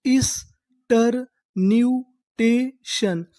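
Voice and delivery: very slow, drawn out; slow syllable-by-syllable reading. Shown as a demonstration. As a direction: spell it out slowly for students. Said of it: "तो हमारी पूरी meaning क्या बनी?".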